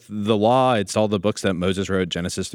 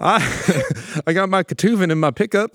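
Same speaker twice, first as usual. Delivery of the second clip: mock small-town American drawl, pitched up; small-town American accent; 8 semitones higher than usual. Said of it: "Small town America".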